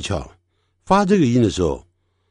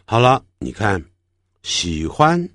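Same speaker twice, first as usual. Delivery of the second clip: normal talking voice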